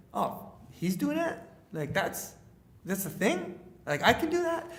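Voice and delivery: halting, pitch climbing into a question; dawning disbelief. Retold or imitated. Young speaker realising this is a real job, dawning disbelief. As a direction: let the surprise rise to a high-pitched question. Reacting to a surprising career revelation.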